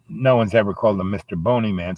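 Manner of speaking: ordinary delivery, nothing marked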